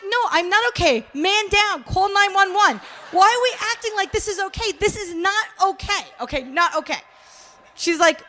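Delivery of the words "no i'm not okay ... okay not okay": high, emphatic retold panic; mock alarm. Skeptic answering a friend who asks if she's okay, alarmed. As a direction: sound the alarm, comically freaked out.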